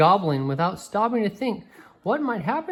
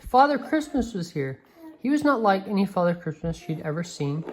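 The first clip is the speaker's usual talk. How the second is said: usual speaking voice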